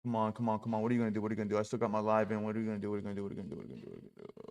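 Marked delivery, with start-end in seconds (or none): 2.4-4.4 repeated question dying away to nothing; fading mutter. Real, not acted. Trader muttering at the chart, fading to nothing. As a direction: let it trail off into silence.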